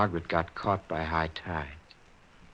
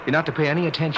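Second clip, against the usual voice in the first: firm insistence; 7 semitones higher, much faster than usual. Acted. Husband insists firmly.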